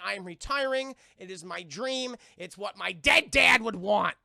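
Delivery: slow, loud on the last line; hammed-up earnest athlete. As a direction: declare it with mock solemnity. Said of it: "He's like".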